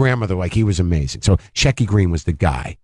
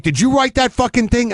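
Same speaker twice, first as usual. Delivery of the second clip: much higher, mock outraged demand; imitating a yell. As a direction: squeal the accusation like an angry old man. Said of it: "Shecky Greene yelling at me".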